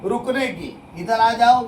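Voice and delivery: normal talking voice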